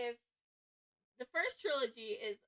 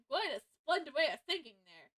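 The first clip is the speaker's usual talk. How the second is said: ordinary delivery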